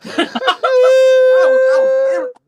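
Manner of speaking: high, loud squealing laugh through the words; laughing hard. Real, not acted. Man laughing at his own story, high squeal. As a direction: lose it laughing, words breaking apart.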